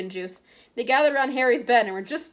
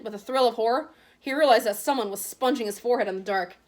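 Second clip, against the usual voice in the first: usual speaking voice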